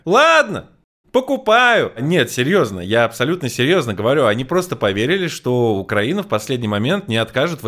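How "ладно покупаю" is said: high, loud, grudging agreement; mock Russia voice. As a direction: play it as a reluctant buyer. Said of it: "И Россия такая".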